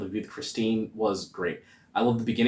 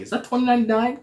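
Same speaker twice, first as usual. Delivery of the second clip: surprised; 3 dB louder, faster than usual. Retold or imitated. His surprise in the theatre.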